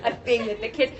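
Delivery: usual speaking voice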